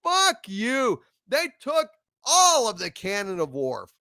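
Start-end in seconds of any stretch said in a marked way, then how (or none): 0.0-1.0 louder, dismissive fuck you; angry retort. Retold or imitated. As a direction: spit it back at the screen. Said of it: "And it's like".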